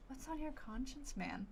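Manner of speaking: ordinary talk, nothing marked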